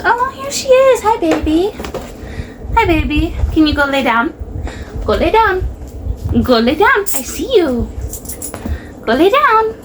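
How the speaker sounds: high, sing-song voice for the pet; dog-talk voice. Real, not acted. Owner greets and scolds her dog.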